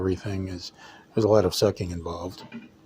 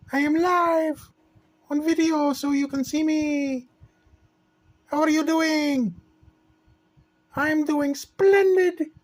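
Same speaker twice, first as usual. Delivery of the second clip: high, sweet little princess voice; 4 dB louder than usual. Pretend. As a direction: play a cheerful make-believe princess.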